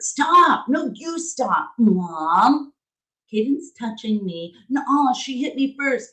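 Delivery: put-on high whiny kid voices, squabbling; imitating bickering kids. Mom retelling her kids squabbling, high and whiny. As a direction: mimic two kids fighting in the back seat.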